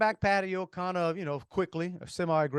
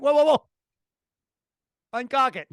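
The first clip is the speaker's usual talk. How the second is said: high, louder retold alarm; acted-out startled objection, pitched up; alarmed protest; 8 semitones higher, 6 dB louder than usual. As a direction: stop them short with a raised, urgent voice. Startled protest at cocked gun.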